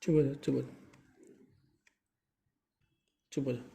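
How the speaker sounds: very soft, low hush to someone crying; gently calm a crying person, almost under the breath; soft consoling. Real, not acted. (बहुत धीमे, प्यार से चुप कराते हुए)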